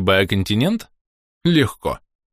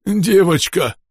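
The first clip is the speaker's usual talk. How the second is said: high, distorted underwater call; gurgling creature; 9 semitones higher, 3 dB louder, much slower than usual. A narrator's reading. Hurt underwater creature calls to the girl, gurgling. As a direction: burble it out in a raised voice. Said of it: "пробулькал показавшийся из ямы ахилот".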